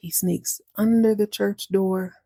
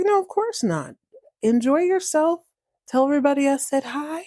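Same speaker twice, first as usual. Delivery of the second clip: high, cheery send-off; encouraging mouse; 7 semitones higher than usual. Acted. Mouse telling cat to enjoy the baptism, high and cheery. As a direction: pitch it up and wave them off happily.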